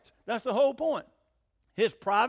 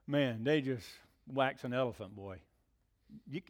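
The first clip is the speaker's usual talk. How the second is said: usual speaking voice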